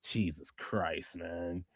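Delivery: low, slow, hushed disbelief; weary sigh curse. Real, not acted. Fan groaning at the Bellas' return, hushed and slow. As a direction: drag it out under your breath.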